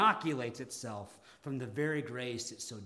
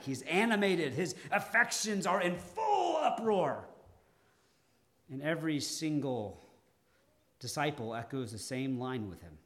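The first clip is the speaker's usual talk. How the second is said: ordinary talk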